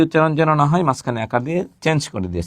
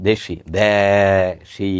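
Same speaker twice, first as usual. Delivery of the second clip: slow demonstration; 4 semitones lower, much slower than usual. Shown as a demonstration. Teacher drawls the word so students hear vowels. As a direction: stretch each দেশি slowly. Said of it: "এই ক্ষেত্রে আপনি খেয়াল করবেন".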